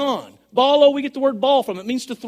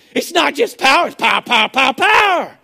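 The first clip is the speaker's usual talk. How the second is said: power, power, power shouted louder each time; loud preaching; 2 semitones higher, 4 dB louder than usual. Real, not acted. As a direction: hammer "power" again and again, loud. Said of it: "power repeated, shouted".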